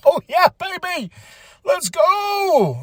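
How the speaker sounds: real excitement, building to a shout; excited cheer. Real, not acted. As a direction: fire yourself up like a fan. Hyped about upcoming main event.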